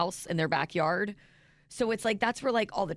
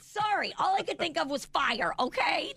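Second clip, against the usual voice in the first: high-pitched, playful self-defence; defensive protest; 8 semitones higher than usual. Real, not acted. Co-host excusing her jump to a fire story, mock defensive. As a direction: protest the teasing in a much higher voice.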